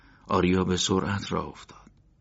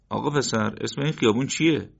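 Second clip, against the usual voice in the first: higher, put-on innocent asking voice; feigned casual stranger; 6 semitones higher, 4 dB louder than usual. A narrator's reading. Undercover agent faking a lost stranger, put-on innocent voice. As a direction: pretend you're just lost.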